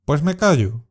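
a touch of offended annoyance; annoyed. Shown as a demonstration. Teacher modelling a mildly annoyed retort for learners. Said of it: "expresando enfado".

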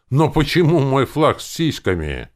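loud, high, baffled protest; indignant question. A narrator's reading. Hero questioning the zombie about his flag, indignant. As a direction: demand an explanation.

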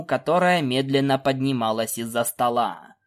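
ordinary delivery, nothing marked

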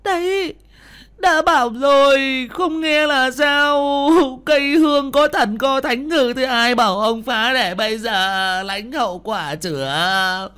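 high, loud, slow drawn-out wailing; bitter scolding. Acted. Wife blaming her stricken husband, bitter wailing scold. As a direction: wail and berate, stretching out the phrase endings. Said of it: "miệng vẫn không ngừng cay đắng nói như mắng".

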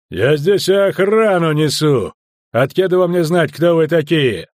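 blustering guard's retort; loud indignant assertion. Acted. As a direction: insist on your authority. Sergeant defends his authority angrily.

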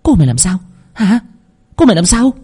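high, urgent demand; angry shout. A narrator's reading. Mother grabbing the maid to demand news of her daughter, shouting. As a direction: yell the question, pitch climbing on the repeat. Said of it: "túm cổ con hầu mà quát".

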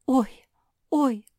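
high, loud little yelps of alarm; frightened. A narrator's reading. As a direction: yelp as if startled. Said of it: "испугалась Луиза".

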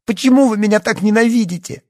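very high, loud anguished question; pleading cry at the top of the voice; bitter outcry. A narrator's reading. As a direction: exclaim it with bitter desperation. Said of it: "с горечью воскликнула".

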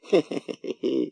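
высокое ехидное хихиканье; похихикай тоненько и ехидно; ехидный смех. A narrator's reading. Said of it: "раздался ехидный смех коротышки". (ехидно хихикает)

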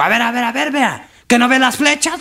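high-pitched, scolding traffic cop. Acted. Traffic cop stopping a drunk wrong-way driver, high-pitched scolding. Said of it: "cogió un agente de tránsito".

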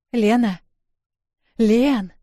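urgently calling her friend's name. Acted.

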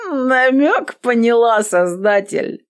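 put-on slow, slightly drunk voice; tipsy. A narrator's reading. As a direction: speak a little tipsy and giggly. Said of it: "Она пьяно хихикнула".

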